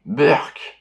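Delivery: grossed-out "berk"; disgusted yuck. Acted. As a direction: spit out in disgust. Disgust at rotten fruit.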